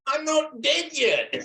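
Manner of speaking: high, slow put-on squeaky protest; hammed-up feeble insistence; protesting old man. Teacher acting the skit for the class, high slow comic protest. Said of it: "And he goes".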